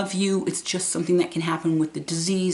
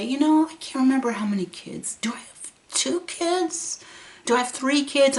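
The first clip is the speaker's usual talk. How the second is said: lightly voiced puzzled mother; imitating confused mom; 9 semitones higher than usual. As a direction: count kids aloud, lost.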